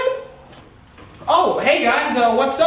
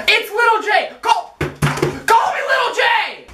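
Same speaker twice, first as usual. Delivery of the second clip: insistent yell; 11 semitones higher than usual. Acted. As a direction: shout the nickname at him. Insisting on nickname.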